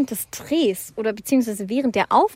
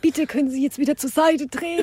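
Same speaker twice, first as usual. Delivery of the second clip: higher-pitched put-on official request; imitating set assistant; 4 semitones higher than usual. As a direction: move onlookers along as the clipboard woman. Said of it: "Sie hat bestimmt so geredet".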